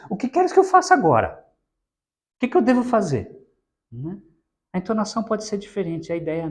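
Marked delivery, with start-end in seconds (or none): 0.1-3.3 high, helpless, bewildered question; a man who has no idea what to do next; lost man's high, bewildered plea. Retold or imitated. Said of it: "ele fica tão perdido que ele diz".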